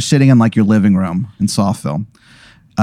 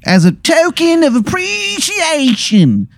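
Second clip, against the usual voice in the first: slow, very high, stretched Baltimore vowels; bad Baltimore accent; slower than usual. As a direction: drag it out high in broad Baltimore. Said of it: "this Baltimore accent".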